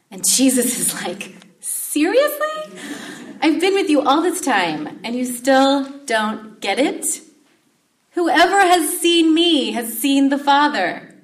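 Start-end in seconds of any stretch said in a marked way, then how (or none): 1.6-7.2 high, slow, loud incredulous "seriously"; exasperated disbelief. Acted. Jesus to Philip who still doesn't get it, loud exasperated disbelief. 8.1-11.1 loud, slow, high proclamation with weight on "Whoever"; emphatic declaration. Acted. Jesus insisting to his disciples, loud slow proclamation. Jesus repeats the line softer.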